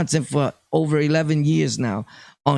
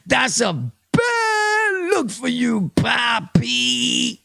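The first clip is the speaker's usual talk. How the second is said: high, slow cackling tease through laughter; real laughter breaking into a mock-pitying jab; laughing taunt; 8 semitones higher, much slower than usual. Real, not acted. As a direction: laugh at him while scolding.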